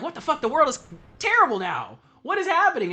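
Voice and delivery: high, shocked outburst on waking; mock shock. Streamer acting out waking from cryo sleep, high and shocked. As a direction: act stunned by a ruined world. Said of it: "And you're like".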